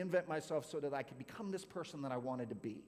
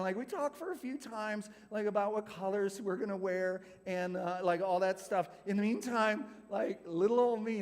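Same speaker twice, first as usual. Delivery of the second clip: ordinary talk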